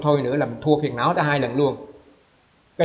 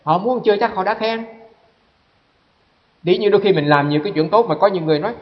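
normal talking voice

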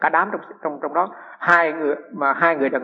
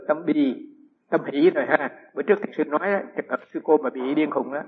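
normal talking voice

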